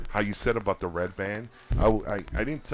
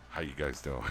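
suddenly lower, deep mock-manly voice; 7 semitones lower, 3 dB quieter than usual. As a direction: drop into a deep grown-up voice. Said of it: "And then I'm like".

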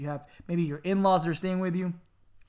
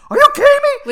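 much higher, louder, laughing disbelief; exasperated disbelief; 16 dB louder than usual. Retold or imitated. Retelling his reply to grandmother, laughing shriek.

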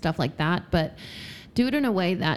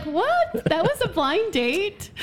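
high-pitched, drawn-out mock disbelief; incredulous; 12 semitones higher, much slower than usual. Woman retells her reaction to a blind date, incredulous. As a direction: act out stunned disbelief, stretching the question.

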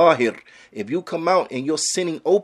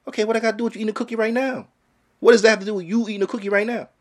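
fast mock retort; 7 semitones higher, faster than usual. Mocking critic's retort.

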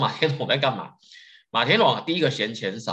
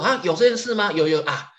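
high-pitched mock surprise, playing innocent; feigned forgetfulness; 9 semitones higher, 4 dB louder than usual. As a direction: act as if it slipped your mind. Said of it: "就装作怎样忘记了啊". (装傻、假装忘记的惊讶口气)

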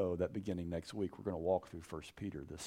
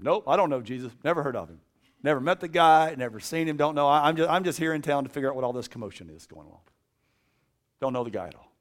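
louder, rushed flustered denying; loud nervous denial; 6 semitones higher, 11 dB louder, faster than usual. Retold or imitated. Peter denying Jesus to bystanders, loud and hurried. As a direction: brush them off, talking fast to get away.

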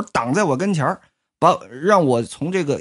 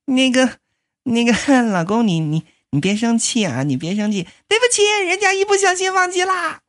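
much higher, cutesy excuse; sheepish girlish apology; 6 semitones higher than usual. Acted. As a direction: wheedle him not to be angry. Susie admits she forgot the telegram.

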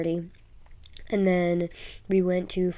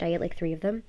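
ordinary talk, nothing marked

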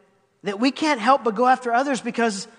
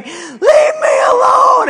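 much higher, slow, loud crying shout; desperate shout; 10 dB louder, much slower than usual. Retold or imitated. Fraternity guy fleeing Suzette across the Quad, crying shout. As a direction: wail it like a hunted man.

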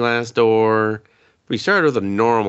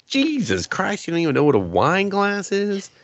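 mock disbelief; 10 semitones higher than usual. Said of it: "I was like".

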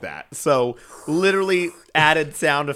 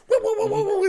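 cheesy sound effect; 4 dB louder, much faster than usual. Retold or imitated.

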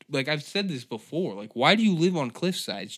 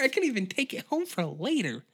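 higher chatty waitress voice; Sally rambling, higher than the chef; 7 semitones higher than usual. Acted. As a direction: gossip on in the girl voice.

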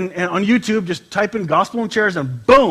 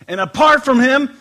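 scripture reading; 7 semitones higher, 6 dB louder than usual. Acted. Reads scripture.

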